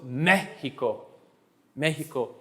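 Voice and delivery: Mexico as MEH-hee-ko, first syllable stressed; measured Spanish Mexico, weight up front. Shown as a demonstration. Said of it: "The accent goes on the vowels".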